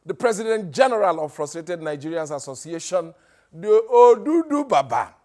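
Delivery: loud hype-man introduction; rally speech. Acted. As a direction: build the crowd up. MC hyping up the guest.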